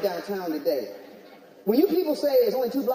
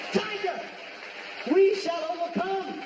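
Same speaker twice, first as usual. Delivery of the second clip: mock solemn anthem; 10 semitones higher than usual.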